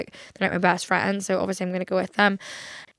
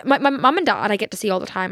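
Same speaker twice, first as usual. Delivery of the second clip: ordinary delivery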